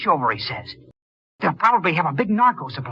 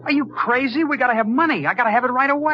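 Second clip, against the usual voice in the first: exasperated; 9 semitones higher, faster than usual. Acted. Jimmy snaps at Doris.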